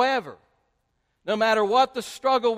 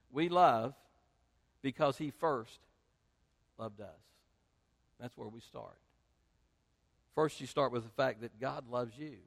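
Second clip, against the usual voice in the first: soft scripture reading; 9 semitones lower, 7 dB quieter than usual. Acted. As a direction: let the verse settle gently. Quiet scripture passage.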